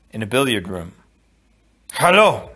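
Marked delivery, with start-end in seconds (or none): 1.9-2.5 raised, higher hello; bold greeting. Acted. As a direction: announce yourself proudly. Inigo's planned grand greeting.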